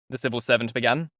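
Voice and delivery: ordinary delivery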